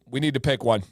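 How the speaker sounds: usual speaking voice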